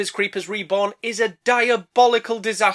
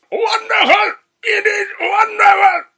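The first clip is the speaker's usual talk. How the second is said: high screeching cry; goblin shout; 14 semitones higher, 3 dB louder than usual. Acted. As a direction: screech it in delight.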